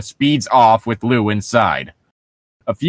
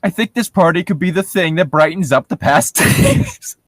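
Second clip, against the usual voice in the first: reading with a laugh breaking in; can't hold the laugh; laughing through it; 5 semitones higher than usual. Acted. Friends mocking the story, laughing read.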